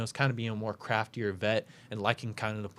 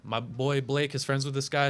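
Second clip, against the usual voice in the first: ordinary delivery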